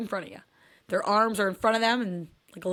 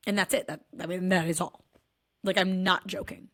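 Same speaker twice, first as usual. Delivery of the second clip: ordinary talk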